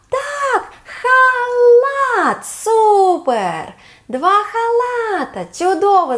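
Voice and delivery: excited cheer. Acted. Teacher cheers a matched pair for the child. Match found in memory game.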